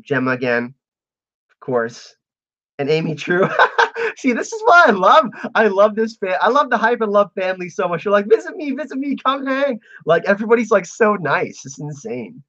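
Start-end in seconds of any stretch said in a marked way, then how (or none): 3.5-4.1 high burst of laughter; real high-pitched giggle; amused laugh. Real, not acted. Streamer delighted by eager fans in chat, amused laugh. Reacting to fans' invitations. 8.3-9.8 high, pleading fan voice; imitating eager fans. Streamer mimicking fans begging for a visit, eager.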